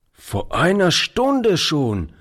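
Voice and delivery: high, slow exclamation; old man's mild outcry, pitched high; exclaiming. A narrator's reading. Old man learns the mother left long ago, exclaiming. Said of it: "rief der Alte".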